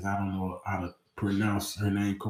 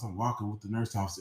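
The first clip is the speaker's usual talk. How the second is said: ordinary delivery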